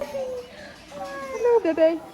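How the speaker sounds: put-on high sing-song baby talk. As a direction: coo at the baby goat. Greeting goats, baby talk.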